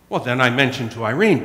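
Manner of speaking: ordinary talk, nothing marked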